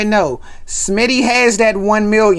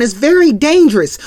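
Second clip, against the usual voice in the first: loud lecturing; 7 semitones higher, 5 dB louder than usual. Retold or imitated. Theo lecturing loudly.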